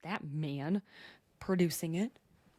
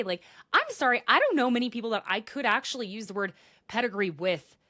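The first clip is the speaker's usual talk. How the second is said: ordinary delivery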